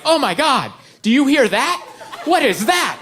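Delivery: high-pitched hypochondriac panic; mock alarm. Wife wakes up to a brand new disease, alarmed. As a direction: act out an alarmed wife discovering a new symptom. Wife's morning panic over symptom.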